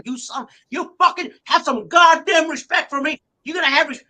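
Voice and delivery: high, loud shouting for respect; furious yell. Retold or imitated. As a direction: demand respect at full volume. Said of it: "I started yelling at him".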